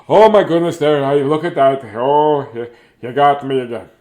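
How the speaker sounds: loud, hammed-up fright; mock-scared grandpa. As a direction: play a startled old man, overdone. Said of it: "not like this".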